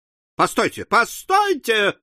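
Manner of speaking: rising cry to halt; urgent exclamation. A narrator's reading. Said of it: "воскликнул маленький мистер Перкер".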